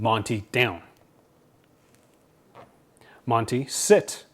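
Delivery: slow, firm commands to the dog; authoritative dog command. Retold or imitated. Owner ordering his dog Monty, firm and slow. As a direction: order the dog down, no room to argue. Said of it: "my tone is very authoritative".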